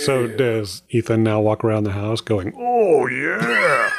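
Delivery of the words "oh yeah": high growly "Oh, yeah" that lands well short of the real thing; a deliberately poor wrestler catchphrase, pitched up; bad Macho Man Randy Savage impression. Podcast host mocks how her son walks round the house, in a bad wrestler voice. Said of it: "that's how bad my impressions are".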